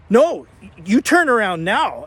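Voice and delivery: mock-authoritative command, punched out; bossy order. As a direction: order someone back, high and forceful. Retold man ordering them back.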